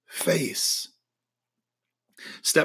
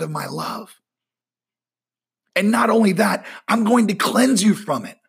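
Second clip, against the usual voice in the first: voicing God; 5 semitones higher than usual. Preacher giving God's words of forgiveness, swelling and fervent. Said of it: "God is saying".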